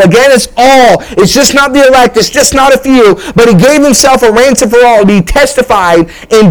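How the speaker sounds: full-force sermon delivery; preaching. Acted. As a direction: drive the message home to the congregation.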